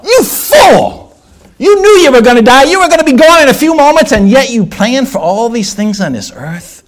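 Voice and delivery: high, loud condemnation, easing at the end; thundering rebuke. Acted. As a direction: hurl an accusation from the pulpit. Acts out the angel's condemning inscription.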